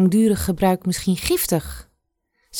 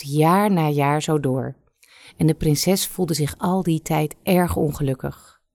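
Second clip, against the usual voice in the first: plain reading voice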